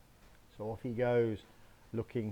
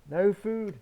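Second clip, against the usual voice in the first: exclaimed; 8 semitones higher, 8 dB louder than usual. Acted. Wolf finds empty cupboard.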